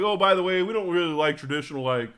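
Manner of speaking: host's casual announcement. Retold or imitated. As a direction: tell it like a host apologising lightly. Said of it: "they're like".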